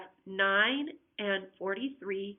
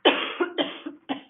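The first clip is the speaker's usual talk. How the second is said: rough repeated cough; 7 semitones higher, 7 dB louder than usual. Real, not acted. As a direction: cough it out hard. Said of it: "Sorry for coughing".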